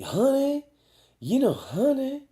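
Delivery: put-on high sugary old-lady "honey"; mock granny cooing; grandmother voice. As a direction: coo it like a doting grandma. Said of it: "she was".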